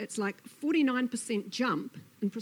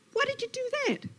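very high, squeaky storybook fright; startled Lizard; faster than usual. Acted. Lizard jumps back from hissing Snake, startled. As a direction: squeak the question in alarm.

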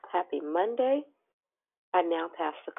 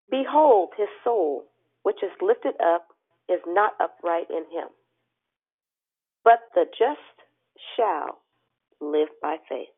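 scripture reading; 5 dB louder, slower than usual. Acted. Reads the day's scripture aloud.

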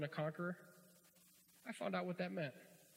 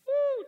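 loud, very high "woo"; high whoop; 18 dB louder than usual. Real, not acted. Preacher relieved at defusing the boss, high whoop. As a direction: let out a sudden piercing whoop.

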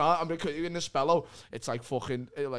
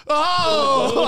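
high, loud groaning Oh; loud groan at missed corner; 13 semitones higher, 12 dB louder than usual. Retold or imitated. Fan groaning at a missed corner, loud and high. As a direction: react to a wasted corner. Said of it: "he'd go".

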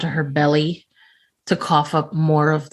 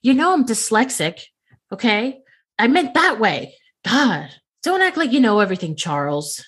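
higher, exasperated scolding; indignant outburst; 6 semitones higher than usual. Acted. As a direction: huff at him like he's the clueless one. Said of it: "Be like".

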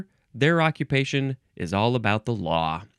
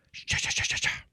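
voiceless rhythmic cha-cha burst; whispered sound effect; 6 dB quieter, faster than usual. Pretend. Host making a transition effect, whispered rhythmic cha-cha. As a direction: chuff it out softly without voice. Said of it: "to my sound effect".

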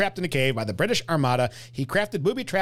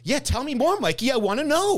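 eager; 8 semitones higher than usual. Retold or imitated. Voicing the viewer's eager reaction.

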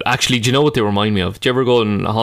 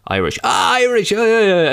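high, delighted recognition, imitated; pitched-up, eager exclamation; shouting hawker; 10 semitones higher than usual. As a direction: mimic a tout gleefully clocking a nationality. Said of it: "they'll shout stuff over you".

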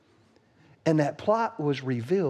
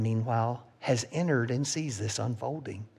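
ordinary delivery

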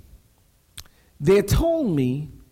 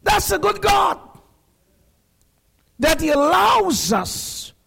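full-force preaching; 5 semitones higher than usual. Acted. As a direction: climb toward a shout, pounding each "If".

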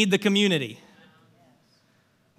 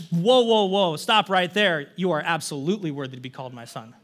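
tender father; 5 semitones lower than usual. Retold or imitated. Father interrupting son.

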